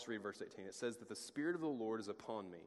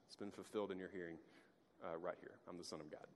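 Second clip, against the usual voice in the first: softer and lower, almost confiding; quiet claim; 6 semitones lower, 10 dB quieter, faster than usual. Pretend. Preacher voices Jesus quietly claiming to be the Son of God. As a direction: drop the voice and let it settle. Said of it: "He says".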